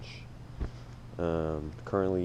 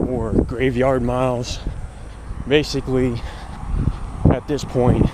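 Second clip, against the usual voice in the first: while running. Real, not acted. Check-in at mile three.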